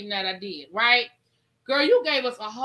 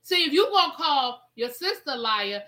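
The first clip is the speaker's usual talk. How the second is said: ordinary talk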